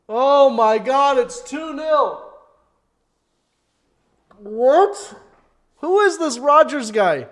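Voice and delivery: high, loud and drawn out in disbelief; shocked yelling. Real, not acted. Fan watching the match yells at the second goal, disbelieving. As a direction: shout it out, stunned and appalled.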